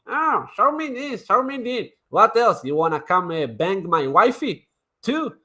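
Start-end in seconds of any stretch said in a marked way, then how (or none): 0.1-1.9 high mocking whine of a nosy viewer; high-pitched mock begging; mocking whiny viewer. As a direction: mimic an annoying viewer begging.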